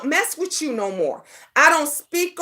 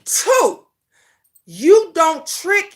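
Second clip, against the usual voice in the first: usual speaking voice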